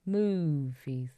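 "movies" with a long mooed first syllable; cow-pun moo. Pretend. Presenter milks the cow pun, mooing the word "movies".

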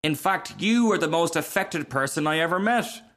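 high, emphatic accusation; indignant cry. A narrator's reading. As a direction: cry it out, scolding a show-off. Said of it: "cried the Bengal light".

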